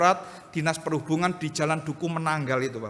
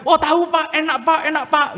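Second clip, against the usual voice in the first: high, louder eager voice of passers-by; eager praise; 11 semitones higher, 8 dB louder than usual. Retold or imitated. Passer-by praising gado-gado to the pastor, high and eager. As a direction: gush about the food like a happy local.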